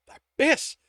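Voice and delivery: loud, high burst of a curse; sharp curse. A narrator's reading. Tibbs as the metal flies loose, sharp loud curse. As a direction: spit the curse out in shock. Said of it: "Tibbs cursed".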